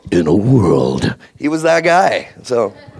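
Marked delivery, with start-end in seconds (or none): 0.1-1.1 very low, slow epic trailer voice; Don LaFontaine trailer voice. Retold or imitated. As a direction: rumble it out like the classic movie trailer opener. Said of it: "he's the original".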